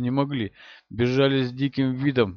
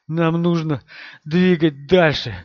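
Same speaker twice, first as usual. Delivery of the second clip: breathless, slow, louder urging; out of breath; 4 semitones higher, 5 dB louder, slower than usual. Acted. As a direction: push it out between gasps. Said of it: "задыхаясь".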